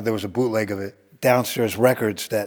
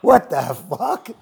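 retold angry shout; 4 semitones higher, 4 dB louder, slower than usual. Learning of unbooked show on radio.

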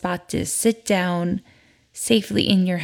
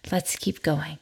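soft whispered nudge; whispering; 3 semitones lower, 6 dB quieter than usual. Acted. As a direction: whisper it encouragingly. Encouragement during exercise.

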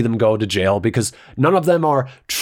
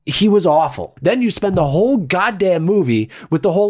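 ordinary delivery

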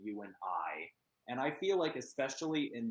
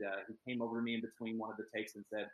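ordinary talk

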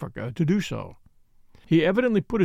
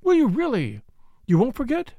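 high, eager questioning; eager cry; 8 semitones higher than usual. A narrator's reading. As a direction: press him keenly for a promise. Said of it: "cried Flora".

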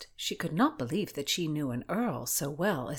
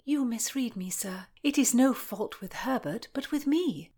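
put-on earnest young gentlewoman; 7 semitones higher than usual. A narrator's reading. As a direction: confess it in a raised, delicate voice. Voices Constance confessing.

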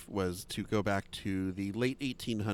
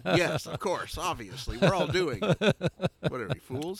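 mock smug ballplayer; 8 semitones higher, 4 dB louder than usual. Voicing old ballplayers on cocaine.

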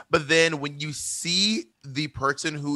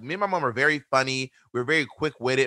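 usual speaking voice

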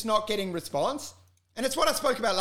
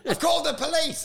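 mock yell; 8 semitones higher, 3 dB louder than usual. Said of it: "I yelled it".

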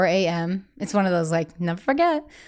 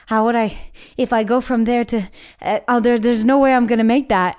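climbing higher and louder, from worried to alarmed; rising panic; 4 semitones higher, 4 dB louder than usual. Retold or imitated. Reading an impossible schedule before a panic attack, panic building louder. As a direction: let the dread build as the schedule sinks in.

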